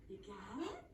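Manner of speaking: usual speaking voice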